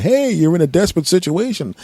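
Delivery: high, mocking caricature voice; comic high-pitched tow truck operator; tow truck guy. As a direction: call it out high, like a smug driver. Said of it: "some stupid tow truck guy going".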